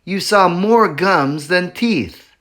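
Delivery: plain reading voice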